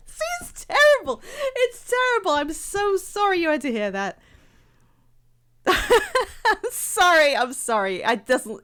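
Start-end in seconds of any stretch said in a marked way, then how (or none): 0.1-7.3 high, helpless laughter breaking into the words; laughing apology. Real, not acted. Streamer sorry viewers had to hear that, laughing apology. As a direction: apologise while cracking up. Cracking up after failed impression.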